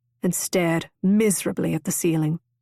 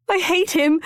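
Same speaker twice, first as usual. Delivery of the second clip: high, louder tearful seethe; seething; 10 semitones higher, 4 dB louder than usual. A narrator's reading. Constance sobbing on Rosecroft's shoulder, seething. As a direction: spit out the hatred through tears. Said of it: "she seethed".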